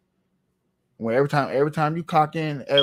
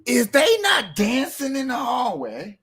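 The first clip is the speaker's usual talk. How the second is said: high, drawn-out rhetorical question; mock incredulous question; 10 semitones higher, slower than usual. Host questions audience about dancing kids, mock incredulous. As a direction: stretch it high in your own accent.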